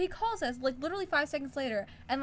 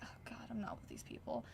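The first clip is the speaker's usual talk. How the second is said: whispered, much softer aside; embarrassed whisper; 14 dB quieter, faster than usual. Retold or imitated. Teen embarrassed by rowdy adults, hushed whisper. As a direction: whisper it like nobody should hear.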